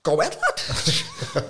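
chuckling proud dad approval; dad laughing. Retold or imitated. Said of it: "He's like".

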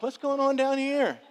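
high, slow, drawn-out call; naive calling out. Acted. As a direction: call down a hallway like a clueless movie victim. Himself as the clueless horror character.

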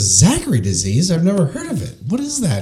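climbing to a high, puzzled question; mock bafflement, pitch shooting up; baffled patient. Baffled patient voiced in joke.

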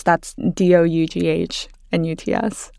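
"D O U G H N U T S" spelled letter by letter; spelling it out. Shown as a demonstration. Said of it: "I always have to explain that".

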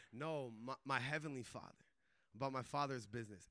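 low, soft retold answer; quiet earnest reply. Correcting the guard about his heavenly father, quiet and earnest. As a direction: gently correct him, voice dropped low.

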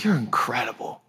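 breathy unvoiced whisper of wonder; awed whisper. Speaker whispering thanks to God in the car, awed whisper. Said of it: "I said".

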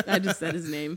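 normal talking voice

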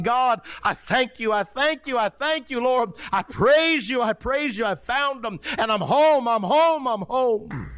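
high, ecstatic cries of thanks, acted out; retold rejoicing. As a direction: imitate a man praising God in high, pealing repetitions. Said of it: "And he's rejoicing".

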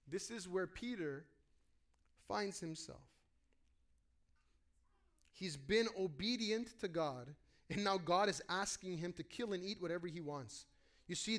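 ordinary delivery

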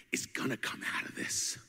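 hushed whisper of hope. Real, not acted. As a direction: drop to a whisper, leaning in.

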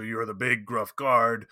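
slow, subtly gruffened guard voice; big gruff guard. Shown as a demonstration. Game master showing players a subtle voice change, gruff guard. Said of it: "subtly change your own voice".

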